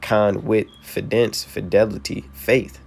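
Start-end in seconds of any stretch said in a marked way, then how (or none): 0.0-1.4 con with fidence, slowly pulled apart into its parts; split into syllables. Shown as a demonstration. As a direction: break confidence down slowly, piece by piece. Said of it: "Confidence means".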